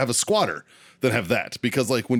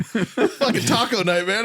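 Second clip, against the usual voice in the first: laughing; 11 semitones higher than usual. Retold or imitated. Laughing through Jason's quote.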